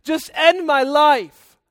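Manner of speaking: loud, high, woe-is-me wail; over-the-top melodramatic lament to God; comically dramatic Jonah. Acted. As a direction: ham up the self-pity at full volume. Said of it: "he's being so dramatic that it's just comical".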